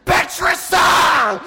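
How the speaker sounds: long, high, loud yell; drawn-out enraged shout; furious scream. A narrator's reading. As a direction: scream the name slowly in rage. Enraged duck screams his name.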